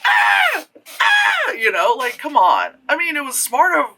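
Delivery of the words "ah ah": mock killer yell. Ghostface yelling through the wall, hammed-up shriek.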